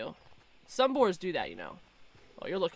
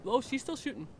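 ordinary talk, nothing marked

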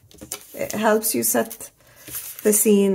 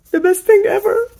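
high, loud delighted squeal; excited gush; 12 semitones higher, 7 dB louder, faster than usual. Real, not acted. Gushing over sticker designs.

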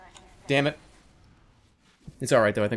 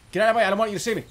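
high, loud frustrated yell; 9 semitones higher, 3 dB louder, much faster than usual. Real, not acted. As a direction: shout it, panicking to get past. Shoving past, escalating.

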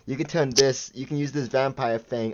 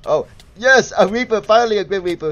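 high, loud, fast burst of joy; thrilled gamer yelling at a rare spawn; excited; 8 semitones higher, 8 dB louder than usual. Real, not acted. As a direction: cheer the Reaper's arrival, quick and loud. Rare boss finally spawns.

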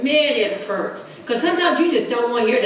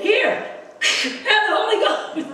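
much higher, slow, laughing; laughing offer; 10 semitones higher than usual. Retold or imitated. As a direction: hand it over with a laugh. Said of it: "and he said".

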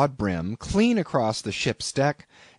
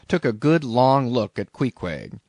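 ordinary delivery

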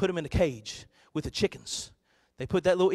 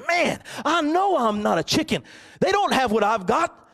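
higher, louder thrilled eaglet; excited eaglet; 8 semitones higher, 5 dB louder than usual. Acted. Eaglet amazed at his own wings, excited. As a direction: burst out with joyful discovery.

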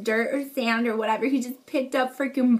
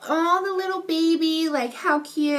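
put-on higher cooing over the baby deer; 5 semitones higher than usual. Retelling her reaction to seeing the fawn, cooing. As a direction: gush over something tiny and adorable. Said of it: "and I was just like".

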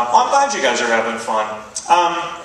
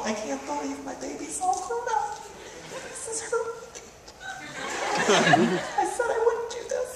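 much higher, softer, teary-mom voice; emotional mom; 9 dB quieter than usual. Retold or imitated. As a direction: play a mom choking up at drop-off. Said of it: "moms get all emotional".

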